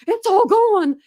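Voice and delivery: hammed-up panic over losing everything; panicky man imitation. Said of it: "the guy was freaking out".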